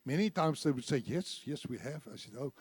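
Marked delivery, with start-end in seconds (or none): 1.0-2.1 murmured. Retold or imitated. Shoppers' murmured answer.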